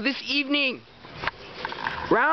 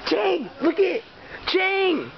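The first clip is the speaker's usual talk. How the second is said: cheering on a fight; 5 semitones higher than usual. Real, not acted. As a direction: yell him on, buzzing with excitement.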